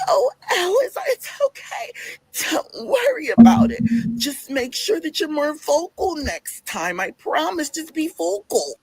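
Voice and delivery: high, drawn-out put-on voice; hammed-up wail of forgiveness, stretched words; mock coworker voice. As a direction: imitate a coworker in a slow, high whine. Acting out Bernadette's drawn-out forgiveness.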